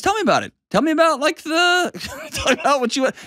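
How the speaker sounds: high, laughing eager ask; curious eager invitation. Pretend. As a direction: lean in, delighted and curious. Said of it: "be like".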